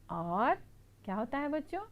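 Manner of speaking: ordinary talk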